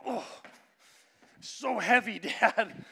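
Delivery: high mock strain of a little boy; hammed-up kid groaning under the weight; son straining. Son struggles with milk gallon, mock-strained and laughing. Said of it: "and he's like".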